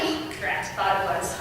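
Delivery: low, soft disappointed mutter; quiet aside. Acted. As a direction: grumble it under your breath.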